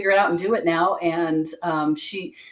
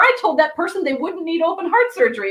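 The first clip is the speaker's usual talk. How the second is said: mock panic; 11 semitones higher, 4 dB louder than usual. Mock panic after a reading.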